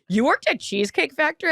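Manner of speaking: high, amazed question; imitating a husband's disbelief; mock astonished query; surprised husband. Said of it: "Erin's husband's like".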